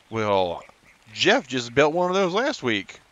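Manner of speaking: aliens with a light Southern twang. Retold or imitated. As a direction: drawl it like a casual Southern alien. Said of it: "southern twang in their voice".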